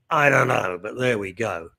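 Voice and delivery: usual speaking voice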